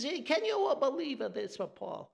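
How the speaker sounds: high, frantic Italian neighbor voice; Italian accent. Retold or imitated. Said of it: "the Italian thing".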